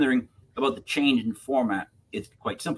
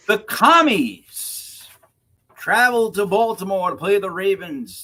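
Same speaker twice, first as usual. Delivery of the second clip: drawn-out announcement; 6 semitones higher, 7 dB louder, slower than usual. Acted. Drawn-out announcement of team name.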